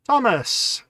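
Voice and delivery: high, loud greeting, falling at the end; calling out a greeting. Real, not acted. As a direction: hail a friend who has just turned up. Greets viewer arriving in stream.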